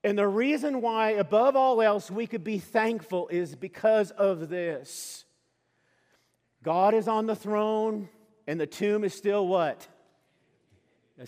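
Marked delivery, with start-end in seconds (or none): 0.0-9.8 loud, high, rousing preaching; preaching at full force. Acted. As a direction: lift the congregation to the climax. Climax of the sermon.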